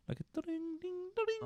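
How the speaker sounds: very high, soft harp plinks; tinkling mock harp sound; imitated harp. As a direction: mimic plucked harp notes with your voice.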